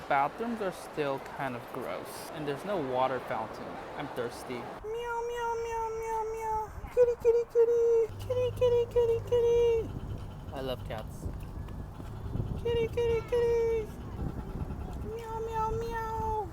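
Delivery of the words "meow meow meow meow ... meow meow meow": very high squeaky meows and kitty calls; high baby-talk kitty call; cat-call voice. Pretend. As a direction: coax the cats in a tiny high voice. Calling cats in the street.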